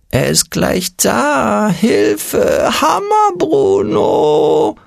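very high, stretched panicky wail; screeching little voice. A narrator's reading. Little voice panics at Hammer Bruno's approach, screeching. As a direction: squeal for help, dragging out the name. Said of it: "kreischte das Stimmchen".

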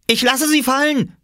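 put-on very high, loud child's threat; calling out. A narrator's reading. Small boy dangling clothes over water, high threatening call. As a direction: shout it at the top of your range. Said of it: "rief Oskar".

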